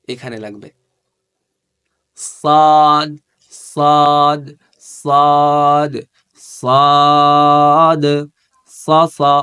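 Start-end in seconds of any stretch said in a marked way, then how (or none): 2.2-9.3 সাদ thick and full, louder, drawn out; loud, heavy Arabic সাদ; heavy sad. Shown as a demonstration. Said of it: "এই সদ কিন্তু মোটা".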